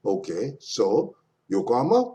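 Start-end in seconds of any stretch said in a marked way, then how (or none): 1.5-2.1 "Yokohama" lifted high into a question; rising intonation. Shown as a demonstration. Teacher echoing a place name, "Yokohama" rising as a question. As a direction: swing "Yokohama" upward at the end.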